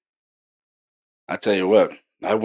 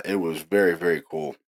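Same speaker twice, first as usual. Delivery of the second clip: normal talking voice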